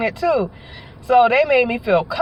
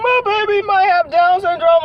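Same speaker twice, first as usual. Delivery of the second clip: high, rising alarm as the news sinks in; panicked; 8 semitones higher, 3 dB louder than usual. Retold or imitated. Mother-to-be hearing the test result, panicked and high. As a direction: replay the moment of panic, pitched up. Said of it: "So I'm like".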